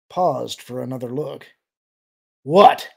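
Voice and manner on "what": loud, irritated challenge; explosive annoyance, rising at the end; snarl. A narrator's reading. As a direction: snarl it as a sharp question. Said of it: "he snarled".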